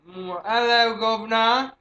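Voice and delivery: hammed-up cockney British "hello, governor"; a lightly put-on British accent; mock British accent. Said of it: "say with a British accent".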